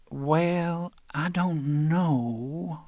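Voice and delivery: aunt hesitating over letting him in; doubtful aunt. Acted. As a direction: stretch it out unsure like the old aunt.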